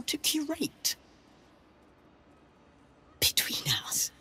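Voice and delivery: conspiratorial unvoiced whisper; librarian whispering. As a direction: share secrets in a hushed whisper.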